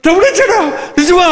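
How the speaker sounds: high, thrilled "తమ్ముడు వచ్చాడా" with a lifted voice; overjoyed "is my brother really back?"; excited disbelief. Pretend. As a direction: gasp it out like joyful news you can't believe. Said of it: "excite అయిపోవాల్సినోడు".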